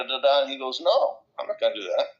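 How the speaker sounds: usual speaking voice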